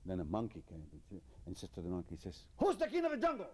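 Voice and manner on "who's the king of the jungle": squeaky high bully of a lion, demanding; bossy lion. Acted. Nasty lion demanding an answer from the animals, in a high squeaky bark. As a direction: play the lion as a pint-sized tyrant.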